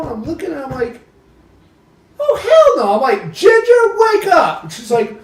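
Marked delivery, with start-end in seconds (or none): 2.2-2.9 high, loud cry of disbelief; retold alarm. Retold alarm at blocked door. 3.3-4.6 high, loud, drawn-out call; retold alarmed shout. Waking Ginger in alarm, retold.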